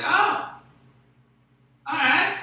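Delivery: loud, high startled reply; taken aback. Retold or imitated. Man turned down mid-act by his date, taken aback. Retelling his reaction to sudden rejection.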